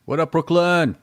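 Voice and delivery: mock Brooklyn New York accent shout-out. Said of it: "I like a good New York accent".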